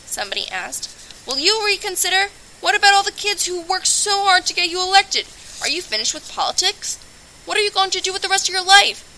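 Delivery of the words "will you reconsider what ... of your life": higher, louder press questions fired off; pushy reporter voice, speeding up at the end; shouting reporters. Acted. Reporters crowd Moon after his resignation, calling out questions.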